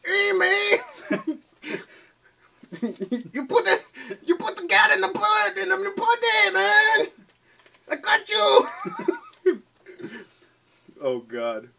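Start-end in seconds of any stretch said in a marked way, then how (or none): 0.1-8.7 high, strained Mexican accent impression; hammed-up high Mexican accent. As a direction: squeal it out in a silly Mexican accent. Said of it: "the only reference you have for Mexican is Cheech".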